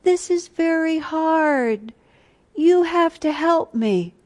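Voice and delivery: put-on high, slow, small childlike voice; a high, slow, childlike plea for help. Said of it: "she had a very childlike way of speaking".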